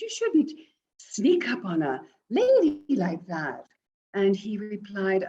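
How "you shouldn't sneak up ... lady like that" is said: startled scold. Acted. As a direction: caught off guard, tell him off.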